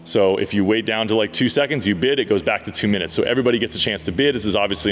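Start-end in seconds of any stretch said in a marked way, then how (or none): none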